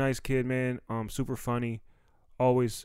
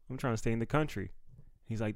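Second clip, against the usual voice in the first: usual speaking voice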